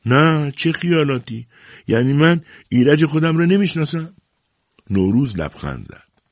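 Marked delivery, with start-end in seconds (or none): 0.1-4.1 high, strained protest; choked voice. A narrator's reading. Shahrbanu insists she knows her own Iraj, choked voice. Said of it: "با صدایی خفه گفت".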